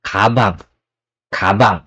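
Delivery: kabang with a hard K at the start; kabang, K sound first; correct kabang. Shown as a demonstration. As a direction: say kabang with a clear K, not G. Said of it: "Not gabang, it's kabang".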